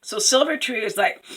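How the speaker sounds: usual speaking voice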